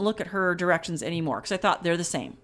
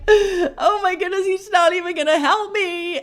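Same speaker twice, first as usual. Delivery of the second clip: dismayed; 9 semitones higher, 4 dB louder than usual. Retold or imitated. Retold panic at rejected call.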